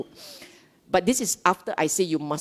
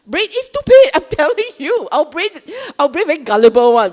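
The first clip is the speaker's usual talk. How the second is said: high, loud, exasperated insistence; loudly insistent, pitched way up; emphatic insistence; 8 semitones higher, 9 dB louder, faster than usual. Real, not acted. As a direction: hammer it home, loud and high.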